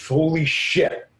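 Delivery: drawn-out "Holy shit" in a changed voice; slow stunned gasp. Retold or imitated. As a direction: dread as the truck hits. Everyone covers their head.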